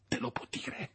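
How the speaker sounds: unvoiced, hushed dread; terrified whisper. Victor whispering to Clerval about the specter, terrified. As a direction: breathe it in horror.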